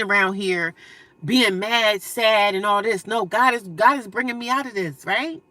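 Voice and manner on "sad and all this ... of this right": ordinary talk, nothing marked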